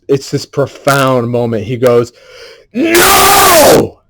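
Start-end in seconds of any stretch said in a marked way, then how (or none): 2.7-4.0 much higher, much louder defiant roar; mock movie shout of no; Caesar's yell. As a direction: roar it like the ape finding his voice. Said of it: "And he yells out".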